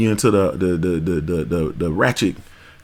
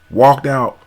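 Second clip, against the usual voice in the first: usual speaking voice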